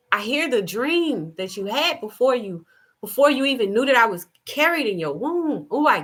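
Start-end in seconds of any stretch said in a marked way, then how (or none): none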